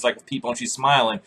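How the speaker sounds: usual speaking voice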